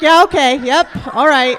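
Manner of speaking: loud, lifted, eager agreement; mock aroused approval. Comic acts out watching Bob Ross paint, eagerly approving. Acting out her aroused reaction to brushstrokes.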